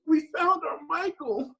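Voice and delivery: a touched casting director declaring it slowly; casting director's announcement. Retold or imitated. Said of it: "and goes".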